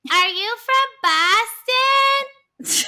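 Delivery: high, loud drawn-out call down the row; mock yelled question. Friend yelling at bachelors down the row, loud drawn-out call. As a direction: belt it like yelling over club noise. Shouting question to guys in club.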